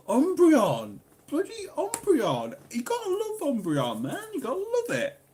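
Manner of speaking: high-pitched, over-the-top gushing; mock fanatical raving. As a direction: rave about it in a squeaky high voice. Mock-fanatical rant about favourite Pokémon.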